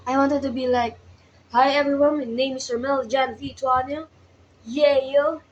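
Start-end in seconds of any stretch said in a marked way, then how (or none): none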